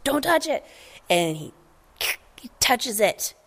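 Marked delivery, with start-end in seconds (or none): 0.0-0.6 high pleading warning; warning dad. Retold or imitated. Marlin warning Nemo again, high pleading dad. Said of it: "It's just".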